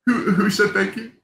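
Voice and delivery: fighting back happy tears; teary-eyed. Real, not acted. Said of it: "Sorry, I'm getting teary-eyed".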